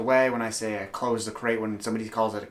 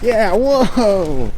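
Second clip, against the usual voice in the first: loud, high whoop, drawn out and falling; excited dog cheer; 10 semitones higher, 11 dB louder than usual. Real, not acted. Owner cheers his dog on, excited. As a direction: hype up the dog with a big whoop.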